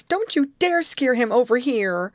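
high, loud, frightened warning; alarmed scream. A narrator's reading. Grace forbidding Amy to scare it their way, alarmed scream. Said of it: "screamed Grace".

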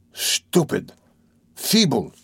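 intense, half-whispered self-scolding, then voiced; self-reproach. A narrator's reading. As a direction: curse yourself under your breath, word by word. Berating himself in his thoughts.

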